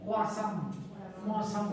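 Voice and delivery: croissant in a nasal French accent, kwasã, very slow on the repeat; croissant said fully through the nose like a French speaker. Shown as a demonstration. Teacher mimicking how the French say croissant, nasal French. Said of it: "con toda la nariz".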